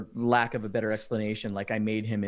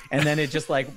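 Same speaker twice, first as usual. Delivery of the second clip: ordinary delivery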